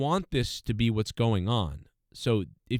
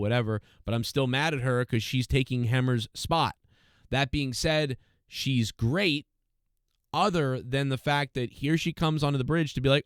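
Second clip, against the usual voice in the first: ordinary delivery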